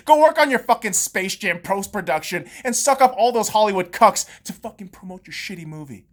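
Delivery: ordinary talk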